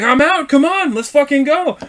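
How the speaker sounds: high, loud goading; retold fighting challenge. Driver daring the other man to fight, retold loud and heated. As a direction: act out being ready to brawl.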